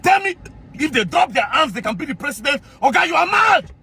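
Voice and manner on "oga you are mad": angry insult. Real, not acted. Insulting the general.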